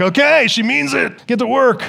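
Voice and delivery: put-on alarmed kid voice. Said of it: "kids are like".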